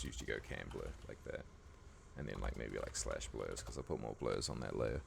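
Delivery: hoarse, croaky throat. Real, not acted. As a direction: speak through a croaky voice. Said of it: "Man, I got the croaky voice today".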